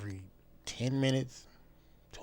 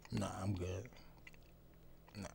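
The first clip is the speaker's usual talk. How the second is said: whispered aside; 5 semitones lower, 15 dB quieter than usual. Real, not acted. Declining jail life, aside.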